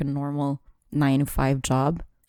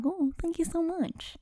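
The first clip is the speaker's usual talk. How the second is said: higher, much softer and slow, playing flattered; flattered thanks; 13 semitones higher, 10 dB quieter than usual. Retelling how she thanked the girl, in a high soft coo. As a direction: coo your thanks, pitched up and hushed. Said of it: "And I was like".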